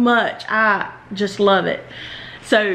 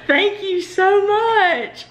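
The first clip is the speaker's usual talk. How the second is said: teary thanks; 8 semitones higher, slower than usual. Real, not acted. Thanking friend for gift package.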